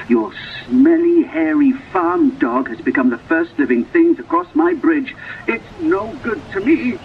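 high, mock-furious devil rant; hammed-up angry devil; devil screaming. A narrator's reading. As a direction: rant at her in a high screech. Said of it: "he screamed".

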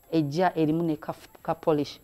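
ordinary talk

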